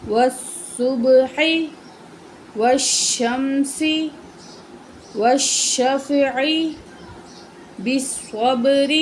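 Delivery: each word drawn out very slowly with pauses; slow line reading. Shown as a demonstration. Teacher reading the full line for the pupil, very slowly. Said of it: "تو پوری line پڑھتے ہیں".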